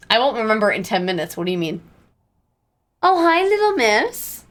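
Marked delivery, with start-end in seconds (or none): 3.0-4.4 high, slow pet-talk greeting; cat greeting. Real, not acted. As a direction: coo at the cat sweetly.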